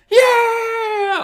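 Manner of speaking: long, high, loud mock cheer; drawn-out high whoop of triumph; crowd cheer imitated. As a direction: cheer like a whole plane celebrating. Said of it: "everyone goes".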